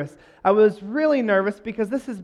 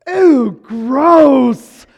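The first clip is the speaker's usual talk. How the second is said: put-on loud disgusted girl voice, higher; 7 semitones higher, 15 dB louder than usual. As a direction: squeal it out in disgust. Girl rejects his note.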